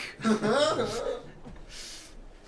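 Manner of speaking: high exasperated laugh. Real, not acted. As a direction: burst out laughing at the failure. Laughs after failure.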